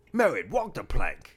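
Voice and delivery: slow, loud laughing pirate voice; Jack Sparrow. Retold or imitated. A husband riffing on the sink ad as a laughing pirate. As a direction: drawl it out like a swaggering pirate captain. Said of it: "Jack Sparrow".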